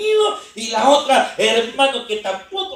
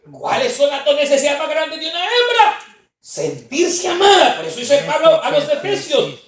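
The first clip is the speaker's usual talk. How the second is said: loud, raised preacher's voice; fiery preaching; 6 semitones higher, 11 dB louder than usual. Acted. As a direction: proclaim Paul's words. Preaching Scripture at full force. (predicación enérgica)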